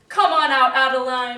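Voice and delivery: loud announcer summons; loud call out. Acted. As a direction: call her out to the stage. Host calls woman onstage.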